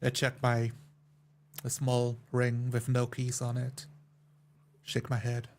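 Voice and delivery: ordinary delivery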